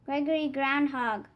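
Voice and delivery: ordinary delivery, nothing marked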